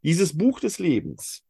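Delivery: ordinary talk, nothing marked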